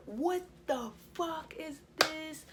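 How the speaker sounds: high, slow outraged mom voice; shocked angry mom. Retold or imitated. Friend's mom spotting a boy in the curtain, high slow outrage. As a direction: play the mom catching them red-handed. Mom discovers hidden boys.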